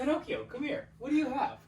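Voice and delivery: high sing-song dog call. Real, not acted. Calling the dog.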